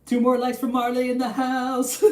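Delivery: high, slow hype shout-out; hype call. Acted.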